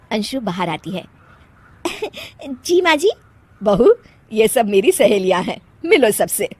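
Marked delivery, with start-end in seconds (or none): none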